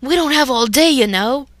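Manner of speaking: loud, high impatient shout; impatient friends shouting at the couple. Acted. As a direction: hurry them along. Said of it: "shouted in unison".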